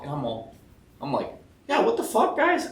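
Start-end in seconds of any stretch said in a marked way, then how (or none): 1.7-2.7 high, squeaky what-the-fuck; mock outrage. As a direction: play up the baffled protest. Retold mock outrage at owner.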